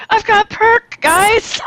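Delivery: high excited quote; excited squeal. Retold or imitated. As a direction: squeal it with glee. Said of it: "was excited and said".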